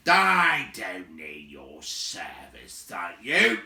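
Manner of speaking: loud, slow gruff rebuff; gruff giant. A narrator's reading. Big man refusing the dwarves, slow gruff. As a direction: brush off the offer. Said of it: "said Beron".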